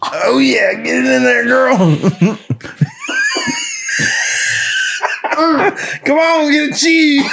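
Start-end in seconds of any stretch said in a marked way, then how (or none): 1.8-6.0 high, shrieking burst of laughter. Real, not acted. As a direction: scream with laughter. Cracking up watching the bite.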